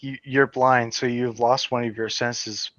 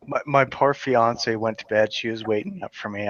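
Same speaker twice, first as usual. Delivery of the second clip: ordinary talk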